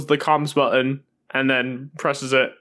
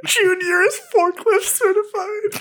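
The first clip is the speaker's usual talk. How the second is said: words squeezed out through high laughter; laughing. Real, not acted. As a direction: barely get it out for laughing. Cracking up at forklift joke.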